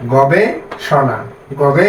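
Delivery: slow, each syllable set apart. Shown as a demonstration. Teacher showing students how to split syllables, slow and deliberate. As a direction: sound out each chunk slowly. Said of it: "তাহলে".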